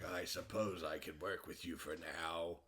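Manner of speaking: slow, grudging villain; mock reluctant agreement; Cobra Commander. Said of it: "the commander's like".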